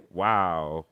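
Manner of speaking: low, unimpressed wow; deflated, low-pitched reaction; underwhelmed wow. Retold or imitated. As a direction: let it drop, deadly unimpressed. Deadpan reaction in retold chat.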